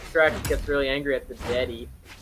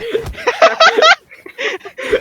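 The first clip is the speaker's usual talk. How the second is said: loud laugh; 12 dB louder than usual. Real, not acted. Laughing at own joke.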